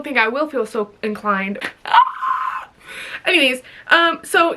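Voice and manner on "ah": very high startled squeal; quick playful shriek. Real, not acted. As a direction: shriek out suddenly. Sudden squeal.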